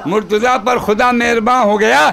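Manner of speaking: measured, projected mushaira-style recital; poem recitation. Acted. Poet reciting praise verse to gathering, declaimed. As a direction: declaim the couplet, lingering on line endings.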